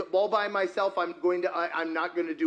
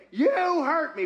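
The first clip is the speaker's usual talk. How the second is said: high, loud mock accusation; acted hurt accusation; 9 semitones higher, 5 dB louder than usual. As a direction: play the wounded accuser at raised volume. Acted accusation, repeated for the audience.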